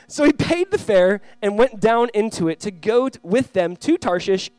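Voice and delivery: loud, high, slow verse reading; emphatic scripture reading. Acted. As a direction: read it out deliberately, driving each phrase home. Reads the Bible verse aloud.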